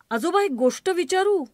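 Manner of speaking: put-on child voice. Child asks grandfather.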